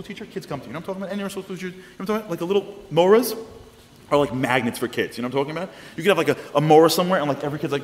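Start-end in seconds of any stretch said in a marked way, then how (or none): none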